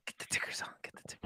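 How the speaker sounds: whispering. Real, not acted. Whispered aside repeating a joke.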